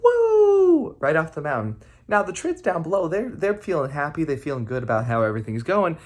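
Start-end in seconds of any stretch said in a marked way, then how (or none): 0.0-1.0 high loud whoop of someone flung through the air; flying whoop. Acted. Trids booted off the mountain, high loud whoop.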